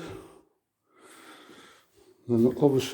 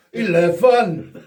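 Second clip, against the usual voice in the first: "Eleven" drawn out slowly as "ilevan", Scottish style; Scottish accent; 7 semitones higher, 9 dB louder, much slower than usual. Pretend. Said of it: "We try Scottish accent then".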